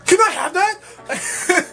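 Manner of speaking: stranger asking. Retold or imitated. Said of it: "and goes".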